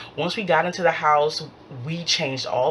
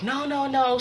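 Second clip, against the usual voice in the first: head them off with higher no's; retold placating; 10 semitones higher than usual. Scammer hearing about the police, high placating protest.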